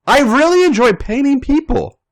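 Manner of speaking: eager painter talking about her craft; Natalia's voice. Acted. Natalia telling Nikki she loves painting, lightly voiced and eager.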